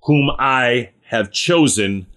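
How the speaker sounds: slow, deliberate voice of God the Father; voice from the cloud. Acted. God the Father speaking from the cloud about his son, slow and solemn. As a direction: read it out slowly, word by weighty word. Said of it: "a voice came from the cloud saying".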